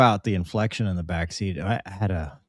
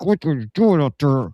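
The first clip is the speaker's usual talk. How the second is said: mock scolding through clenched teeth; tight-jawed impersonation, pitched up; gritted-teeth instructor; 4 semitones higher than usual. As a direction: imitate the instructor turned nasty in the cockpit. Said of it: "it was like Jekyll and Hyde".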